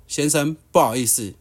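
lightly imitated Taiwanese-accented Mandarin apology. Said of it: "带着一点台湾国语说".

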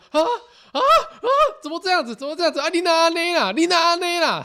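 imitating mom in a squeaky panic; panicked mom. 她就这样一直问我.

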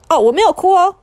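put-on high, loud little-boy voice insisting he isn't crying; squeaky boy swallowing his tears, protesting; boy denying tears. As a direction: blurt it out to hide the tears.

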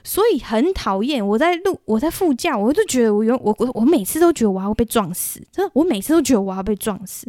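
normal talking voice

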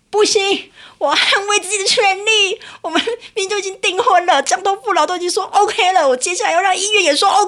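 rallying herself to act; fired-up resolve. Retold or imitated. Resolves to fight for rights. (激动坚定地下决心)

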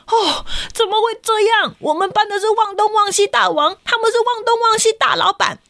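put-on high, indignant child's protest. Kenta protests the rival class's bigger title. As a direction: complain it's not fair.

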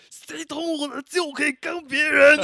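high, loud outraged shout, imitating the friend; hammed-up furious yell at whoever poked him; blowing up in anger. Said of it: "他直接爆气". (模仿朋友被捅后暴怒地高声大吼)

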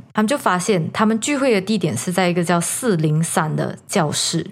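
ordinary delivery

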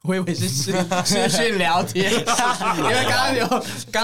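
laughing tease. Real, not acted. Host teasing a guest about video chatting, laughing. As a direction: crack up at your own joke.

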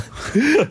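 sudden high-pitched laugh; short squealing giggle; high burst of laughter. Real, not acted. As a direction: laugh out at the absurd scene. Laughing at crying coworker.

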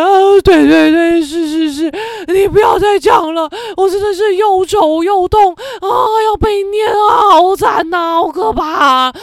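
loud, high, half-crying whine; mock whining protest. Being lectured by the doctor, a loud, half-crying whine. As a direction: whine back at the nagging doctor.

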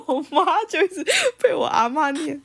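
high pitch, words broken by laughter; laughing while talking. Real, not acted. Telling a friend about grandma scolding mom, laughing. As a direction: talk through the giggles.

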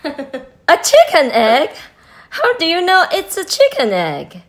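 high, loud disbelieving question; disbelief, asking fast and high; incredulous question. Acted. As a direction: Jessica doubting Marilyn, astonished. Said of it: "asked Jessica".

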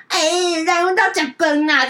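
high, lightly voiced nursemaid coaxing; coaxing nanny. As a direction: usher someone out like a fussing auntie. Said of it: "卖弄她那个奶妈的那个姿态". (学奶妈高声哄着人走)

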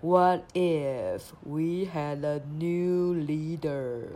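very slow, drawn-out sloth voice. Pretend. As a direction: stretch every word like a sleepy sloth. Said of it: "你要用很慢的声音说".

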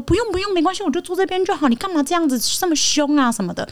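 lightly voiced mom telling her son off; mother's protest. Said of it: "他妈妈就一直在后面说". (轻轻模仿妈妈劝阻)

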